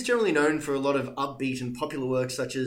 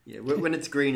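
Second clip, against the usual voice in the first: ordinary talk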